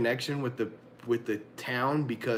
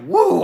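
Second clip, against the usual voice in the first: loud, high "woo"; whooping; 13 semitones higher, 12 dB louder than usual. Retold or imitated. Thirteen-year-old self after escaping the dog, loud whoop. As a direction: let out a big triumphant whoop. Retelling his badass reaction at thirteen.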